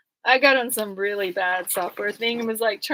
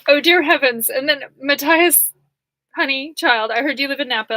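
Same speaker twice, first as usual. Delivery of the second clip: louder, higher, syrupy mock-sweet judge voice; condescending judge; 4 semitones higher, 7 dB louder than usual. Mocking the judge who talks down to her, loud and sugary. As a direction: lay on the fake sweetness.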